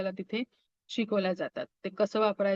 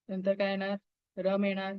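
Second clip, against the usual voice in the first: ordinary talk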